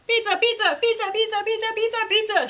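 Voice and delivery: high, insistent, repeated crowd chant; shouting alpacas. A narrator's reading. Alpacas storm after the hidden pizza, high acted shouting. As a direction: chant it high and hungry, without a break. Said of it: "shouted the alpacas".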